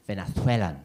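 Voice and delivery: mock Spanish accent on Venezuelan. Comedian imitates half-Venezuelan flatmate, Spanish accent on Venezuelan. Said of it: "That's how he'd say it".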